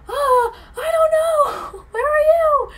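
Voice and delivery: very high, loud, drawn-out yell; shouting back. Retold or imitated. Stranded driver answering searching friends, high wailing shout. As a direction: holler back helplessly across the distance.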